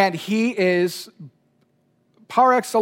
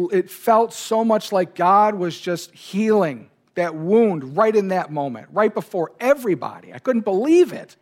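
ordinary talk

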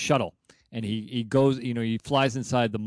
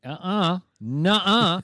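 high, mocking no-no; sassy refusal; 7 semitones higher, 3 dB louder, much slower than usual. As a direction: shake it off with sass. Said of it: "Kirk, of course, is like".

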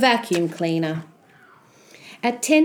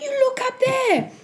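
put-on high-pitched, thrilled young Jack; excited cry; 11 semitones higher than usual. Boy showing mum the golden leaves, high excited cry. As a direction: point out the wonder, high and eager. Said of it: "cried Jack".

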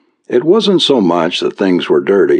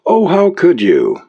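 higher, tearful reproach; sobbing mother; 10 semitones higher than usual. A narrator's reading. Mother reproaching her son, sobbing. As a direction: sob it out as a hurt mother. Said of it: "sobbed the mother".

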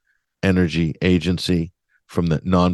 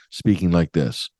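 ordinary delivery

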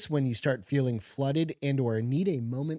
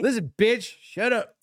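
higher-pitched mock scolding; play-scold your spouse in a raised pitch; mock telling-off; 8 semitones higher, 3 dB louder than usual. Spouse jokingly acting out open communication, mock telling-off.

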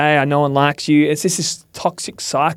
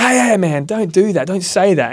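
high, sharp "hey, hey, man"; a hammed-up shaming warning; mock scolding; 6 semitones higher than usual. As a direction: snap at a friend in mock rebuke. Shaming a friend, voiced example.

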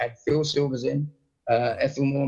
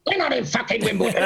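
mock indignant outburst; 9 semitones higher than usual. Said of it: "He went".

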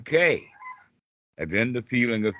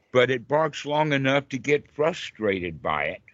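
ordinary talk

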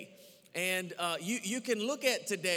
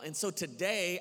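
ordinary talk

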